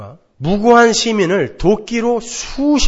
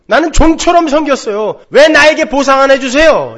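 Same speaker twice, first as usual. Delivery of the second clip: much higher and louder, stressing 종처럼; aggrieved demand; 8 semitones higher, 9 dB louder than usual. Retold or imitated. Elder son complaining to his father, loud aggrieved demand. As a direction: demand the reward you earned. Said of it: "스스로 그렇게 이야기하고 있어요".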